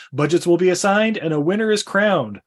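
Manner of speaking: usual speaking voice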